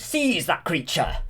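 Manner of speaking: high, drawn-out mock royal order; king's command. The King ordering his men onto Maleficent, mock command. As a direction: order it slowly and imperiously. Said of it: "And then the King goes".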